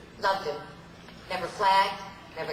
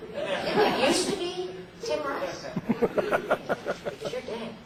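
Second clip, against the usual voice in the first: mock menace; 11 semitones higher, much slower than usual. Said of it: "she jabs at co-star".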